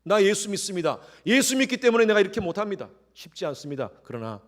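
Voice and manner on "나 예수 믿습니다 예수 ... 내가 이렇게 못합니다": high, louder bold declaration. Shown as a demonstration. Believer boldly refusing coworkers, confident declaration. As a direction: declare it proudly. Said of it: "당당하게".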